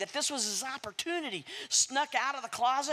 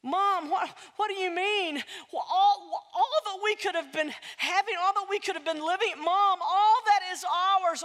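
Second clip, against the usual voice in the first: high, loud, stammering dismay; worked-up Jack; 7 semitones higher, 4 dB louder than usual. Acted. Jack to his mother over their lost wealth, excited dismay. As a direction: spill it out, half laughing in disbelief.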